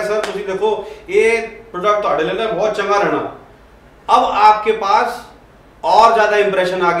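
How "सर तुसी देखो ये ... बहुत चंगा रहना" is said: friendly salesman pitching in Punjabi. Shown as a demonstration. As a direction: win the Punjabi client over in his own tongue. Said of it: "मैं कहा".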